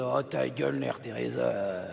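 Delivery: very low, slow, grumbled put-down; grumpy dismissal. Acted. Puppet shutting up the peace-making partner, grumpily.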